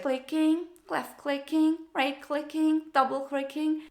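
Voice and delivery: a touch of a clueless IT newbie; imitating Jen. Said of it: "where the girl goes".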